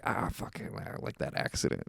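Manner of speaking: hushed, breathy mumble; whispered aside. Retold or imitated. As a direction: mutter it under your breath. Driver quietly reacting, acted quote.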